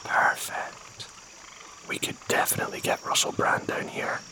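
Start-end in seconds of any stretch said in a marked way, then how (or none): none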